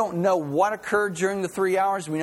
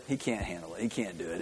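low, soft scoffing; quiet dismissive aside; dismissive doubters; 9 semitones lower, 10 dB quieter, faster than usual. Retold or imitated. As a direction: mutter it like a doubter. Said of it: "most people look at him and say".

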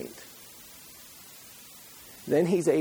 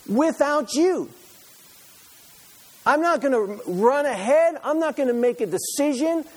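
determined vow; 8 semitones higher, 4 dB louder than usual. Acted. Preacher voicing resolve to God.

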